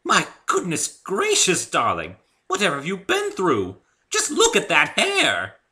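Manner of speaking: high, loud, slow, scandalized; aghast. Acted. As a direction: gasp it out in elegant horror. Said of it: "she said in an aghast voice".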